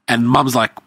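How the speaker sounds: ordinary delivery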